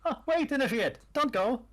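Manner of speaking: calling out as a cultist. Acted. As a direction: call after the recruit in character. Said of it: "the cultist voices?".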